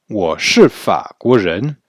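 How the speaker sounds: Wo shi fa guo zhen said very slowly, each syllable set apart; deliberate teacher reading; slow model sentence. Shown as a demonstration. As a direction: model the answer slowly for beginners. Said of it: "Je suis français".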